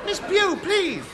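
high, loud scandalised plea; shocked protest. Acted. Embarrassed escort begging Miss Pugh to stop, high shocked protest. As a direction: stop her making a scene. Embarrassed man scolding her.

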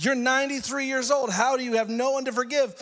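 high, disbelieving challenge; incredulous preacher. Acted. Pastor questioning the old lady, incredulous. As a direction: pitch it way up in disbelief.

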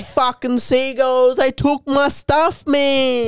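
high, whiny bus-stop junkie. Retold or imitated. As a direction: whine it like a strung-out guy at a bus stop. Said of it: "what it sounds like to me".

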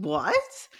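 high squeaky disbelieving what; a sudden high-pitched gasp of disbelief; stunned what. Retold or imitated. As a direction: shoot up high in total shock. Stunned reaction to the dragon.

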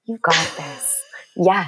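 quietly steady a laboring mother; hushed birth-coach encouragement; doula voice. Shown as a demonstration. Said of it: "get into my doula voice".

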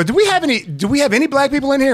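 high, loud shout-out to the audience; calling to the crowd. Retold or imitated. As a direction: project it across a club from the stage.

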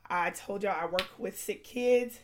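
normal talking voice

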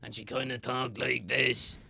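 slow, harsh granny voice; granny from a play, dragging the words out; granny character voice. Shown as a demonstration. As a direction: talk like a rough old granny, drawn out. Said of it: "a character ... that was a granny".

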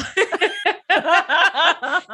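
high giggling laugh; a high, bubbling laugh. Real, not acted. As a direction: break into giggles. Laughing at her own joke.

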